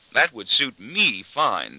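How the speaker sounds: slow, pointed weight on "me"; pointed emphasis. A narrator's reading. Daughter needling her father, leaning on "me". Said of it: "emphasizing her words to indicate".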